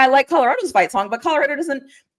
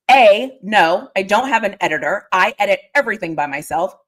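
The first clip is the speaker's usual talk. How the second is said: ordinary talk, nothing marked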